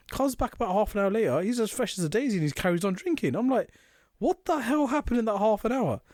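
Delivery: high, incredulous retelling; high-pitched baffled question; disbelief. Retold or imitated. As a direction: ask it in amazed disbelief.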